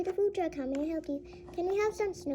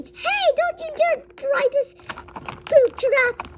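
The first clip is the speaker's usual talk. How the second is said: high, loud, drawn-out yell; angry warning; 12 semitones higher, 9 dB louder, slower than usual. Pretend. Customer warning people off food truck, angry yell. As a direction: yell it out, stretching the words.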